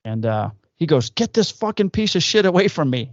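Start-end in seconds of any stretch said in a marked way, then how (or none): 1.1-3.1 put-on high-pitched, thick Greek accent. Old Greek father rejects his son at the door, thick Greek accent. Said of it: "in his thick Greek accent".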